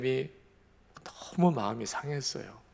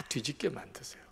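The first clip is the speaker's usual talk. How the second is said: sudden whisper; 2 semitones higher, 8 dB quieter than usual. Real, not acted. Preacher drops to hushed emphasis.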